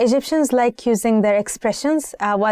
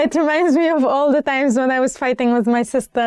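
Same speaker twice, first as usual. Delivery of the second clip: laughing; 3 semitones higher than usual. Real, not acted. Laughing while recalling sister fights.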